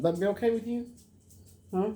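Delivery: high sing-song question to the dog; high pet talk. Real, not acted. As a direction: coax the dog gently. Asking the dog, repeated.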